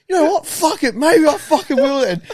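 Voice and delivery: high, loud, spiteful dare; defiant challenge. Retold or imitated. Retelling the mate's defiant reply before licking it up. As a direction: sound like he's calling their bluff. Friend defiantly decides to lick.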